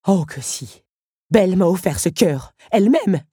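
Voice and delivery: defiant and touching; proud Otto. Acted. Otto defends Belle's gift, proud and defiant.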